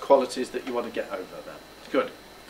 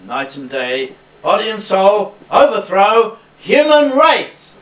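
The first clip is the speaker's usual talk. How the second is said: building pitch; 6 semitones higher, 9 dB louder than usual. Shown as a demonstration. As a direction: start low, climbing to a loud high peak. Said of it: "that's got to build. Start it lower".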